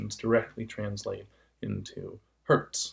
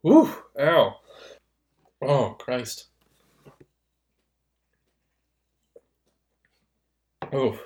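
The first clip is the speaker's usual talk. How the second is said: pained groans; 4 semitones higher, 8 dB louder, slower than usual. Real, not acted. Hurt throat after throat singing.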